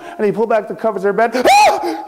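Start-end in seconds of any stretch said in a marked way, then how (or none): 1.3-2.1 sharp high scream of fright; startled shriek. A narrator's reading. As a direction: cry out high and loud. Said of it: "he screamed".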